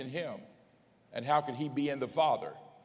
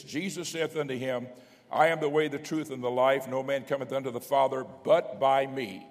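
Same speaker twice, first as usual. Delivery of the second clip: raised, forceful pulpit reading; scripture proclamation; 2 semitones higher, 5 dB louder than usual. Acted. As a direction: declare the verse to the congregation.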